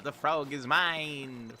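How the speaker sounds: sneering panto villain. Pretend. Gloats over catching frog.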